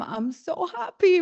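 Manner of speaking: high-pitched happy declaration; happy, excited. Retold or imitated. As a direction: gush about being so happy. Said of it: "I just keep telling them".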